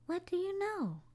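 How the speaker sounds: put-on playful surprise for the children; surprised. A narrator's reading. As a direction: pop up with delight. Discovering David with his harp.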